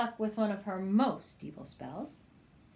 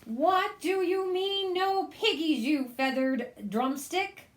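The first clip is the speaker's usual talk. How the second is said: much higher, loud witch screech; 9 semitones higher, 5 dB louder than usual. Acted. Witch screeching at the chicken, high and loud. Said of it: "Gritch screeched".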